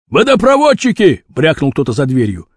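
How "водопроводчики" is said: high call through a door. A narrator's reading. Someone behind the door answers Rashpil, calling out. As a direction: sing out the announcement, pitched up.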